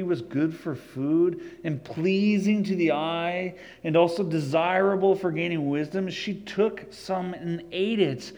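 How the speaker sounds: steady, earnest scripture reading. Acted. Pastor reading Genesis aloud to the congregation, earnest. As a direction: read the passage aloud with weight.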